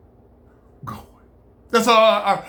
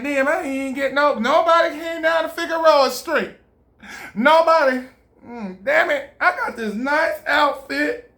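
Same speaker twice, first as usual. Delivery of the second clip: mock whining streetwalker; 6 semitones higher than usual. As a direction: ham up a sulking girl left without customers. Voicing a prostitute complaining.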